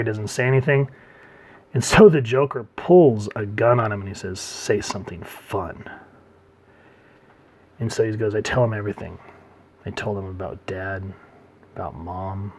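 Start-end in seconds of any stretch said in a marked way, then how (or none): 4.4-5.8 slow, softened gunpoint order; drawn-out, understated threat; quiet menace. Retold or imitated. Joker holding a gun on Six, quietly menacing. 8.3-12.5 soft, low recollection; hushed, trailing admission; subdued confession. Retold or imitated. Six telling Joker about his parents, subdued. Six recalls painful childhood.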